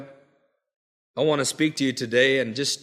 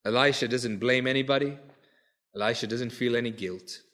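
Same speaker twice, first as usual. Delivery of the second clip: soft, lower recap of the points; quiet summing up; 7 semitones lower, 8 dB quieter than usual. Acted. Preacher recapping Elisha's lessons for the church, quietly. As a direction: wind it down gently.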